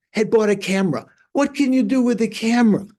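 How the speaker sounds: acted-out enthusiastic question; excited chatter. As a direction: gush with wonder, pitch lifted. Retelling lama gushing about camera.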